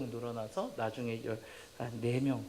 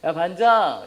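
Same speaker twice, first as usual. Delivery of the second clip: loud, higher taunting call; imitated bully; 4 semitones higher, 12 dB louder, slower than usual. Troublemaker pestering the class president, loud taunting call. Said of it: "반장을 좀 괴롭히는 애들이 있어요".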